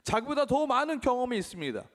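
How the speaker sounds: ordinary delivery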